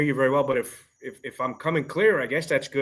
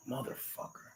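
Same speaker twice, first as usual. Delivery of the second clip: whispered curse, barely voiced; muttered under breath; 20 dB quieter than usual. Real, not acted. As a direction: mutter it so nobody hears. Cursing under breath mid-call.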